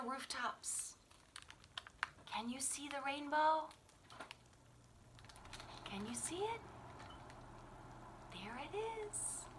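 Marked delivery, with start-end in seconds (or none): 2.3-9.5 much softer, hushed and gentle; soft question to listener. A narrator's reading. Reading to a child, hushed and gentle. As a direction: draw the child in quietly.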